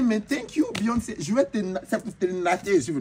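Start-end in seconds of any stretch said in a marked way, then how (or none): 0.0-1.2 gushing thanks. Retold or imitated. Thanking [name], excited.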